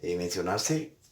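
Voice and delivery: ordinary talk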